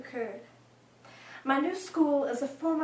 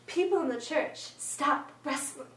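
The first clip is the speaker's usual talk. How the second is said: ordinary delivery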